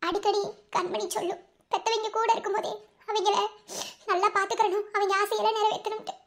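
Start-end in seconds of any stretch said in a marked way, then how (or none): none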